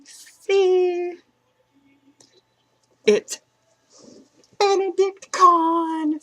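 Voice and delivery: thrilled to show the Benedict Cumberbatch shirt; excited reveal. Real, not acted. Showing the viewers her new shirt, excitedly. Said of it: "So I was so excited".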